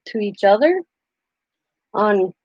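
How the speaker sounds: ordinary talk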